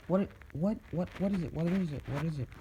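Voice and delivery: high, eager repeated questions; excited pestering. Acted. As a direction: crowd of kids begging to know. Class begging to know the result.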